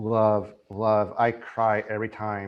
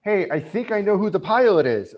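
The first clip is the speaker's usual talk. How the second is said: eager realization; 7 semitones higher, 4 dB louder than usual. Retold or imitated. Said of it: "You have Ezra saying".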